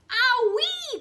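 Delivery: very high, loud pained yelp; crocodile yelp of pain. A narrator's reading. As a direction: squeal as you tumble over. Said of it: "cried the crocodile".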